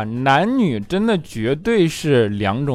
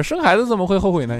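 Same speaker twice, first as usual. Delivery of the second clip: higher mock father voice; mock dad quote; 7 semitones higher than usual. Echoing the dad's words ironically, higher mock voice. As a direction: quote him back ironically. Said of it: "谦说".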